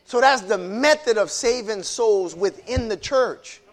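ordinary talk

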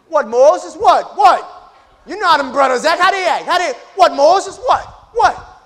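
loud, high street confrontation, taunting; angry shouting. Acted. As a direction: get in his face and yell. Acted street quarrel, Hebrew shouting.

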